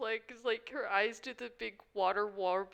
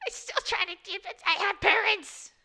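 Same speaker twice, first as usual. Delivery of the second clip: very high, loud distraught wail; acted crying outburst; 10 semitones higher, 8 dB louder than usual. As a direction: build into a pretend sobbing protest. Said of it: "she just cries for a bit".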